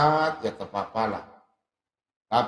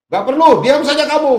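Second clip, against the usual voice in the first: loud, high mock shout; retold angry refusal; 11 semitones higher, 10 dB louder than usual. Imitating the man refusing to answer him, angry shout. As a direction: bark a dismissal down the phone.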